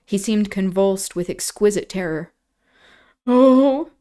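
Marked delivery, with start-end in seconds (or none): 3.2-3.9 sobbing cry. A narrator's reading. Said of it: "he sobbed".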